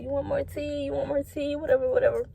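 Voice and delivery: high, soft, overly sweet server offering refills. Retold or imitated. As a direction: chirp it out like a too-eager waitress. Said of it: "I was like".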